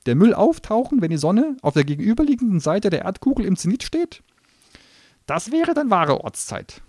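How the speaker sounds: high, loud lecturing gnome voice; pedantic gnome. Acted. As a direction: nitpick like a smug official.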